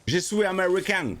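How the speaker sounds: put-on phrase lente avec un léger accent sud-américain d'Amérique du Sud. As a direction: joue l'étranger avec un soupçon d'accent sud-américain. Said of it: "je prends un accent, euh, d'Amérique du Sud". (un léger accent sud-américain, lent et plus aigu)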